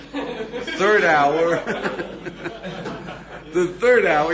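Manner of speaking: loud laughing count of the hours. Real, not acted. Telling the class the beach story, laughing aloud. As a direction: laugh through the words.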